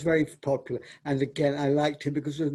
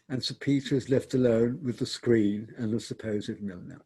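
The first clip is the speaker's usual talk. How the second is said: usual speaking voice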